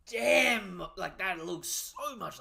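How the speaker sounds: loud, high exclamation; amazed outburst. Real, not acted. Reader amazed by a character's resemblance, loud high outburst. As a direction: burst out in amazement.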